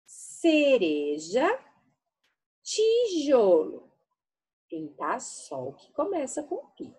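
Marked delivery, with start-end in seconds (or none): 0.0-1.5 Cereja said slowly; teacher sounding out Cereja; slow word demo. Shown as a demonstration. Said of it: "Pode olhar a boquinha". 2.6-3.9 Tijolo said slowly; teacher sounding out Tijolo; slow word demo. Shown as a demonstration. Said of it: "Pode olhar a boquinha".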